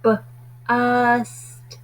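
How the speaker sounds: B-ust split slowly into b and ust; sounded out. Shown as a demonstration. Teacher segmenting B-ust for children, sounded out. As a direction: pull B-ust apart, onset then rime. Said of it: "segmenting word into sounds".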